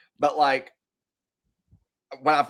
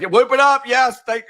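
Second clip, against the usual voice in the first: much higher, louder hammed-up cheering; mock cheer; 10 semitones higher, 7 dB louder than usual. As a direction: chant the catchphrase like a hyped-up fan. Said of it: "even I'm like".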